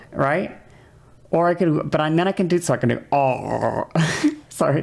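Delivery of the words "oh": low, held "oh" noise; gross low vowel. Shown as a demonstration. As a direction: let out an ugly low oh. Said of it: "that sounded gross".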